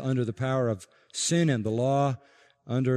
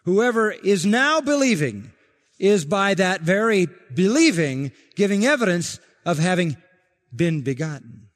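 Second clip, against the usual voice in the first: louder fervent preaching, driving each phrase home; preaching at full force; 5 semitones higher, 6 dB louder than usual. Acted. Preacher to congregation on believing as evidence, fervent. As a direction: pound the point like a revival preacher.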